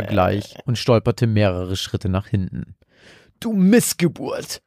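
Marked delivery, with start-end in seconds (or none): 3.4-4.6 slow, higher, understated frightened cry; a mild mock yell in fear; scared boy, lightly voiced; fearful cry. A narrator's reading. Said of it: "schrie er mit angsterfüllter Stimme".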